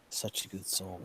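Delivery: soft, quiet grief, a touch of tears; mild grieving. Acted. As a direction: mourn her quietly, holding back tears. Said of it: "he starts crying".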